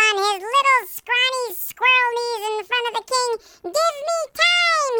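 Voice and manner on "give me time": high, slow pleading squeak; begging. A narrator's reading. Servant on his knees before the king, begging high and slow. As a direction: stretch each word out, begging for mercy. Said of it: "he begged".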